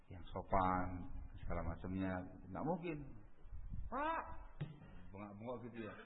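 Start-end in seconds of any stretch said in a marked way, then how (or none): 3.9-4.2 high mock rebuke of an elder; mock harsh scolding. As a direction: imitate a rude youth barking at an elder. Menirukan menegur orang tua dengan kasar. (meniru teguran kasar dengan nada tinggi)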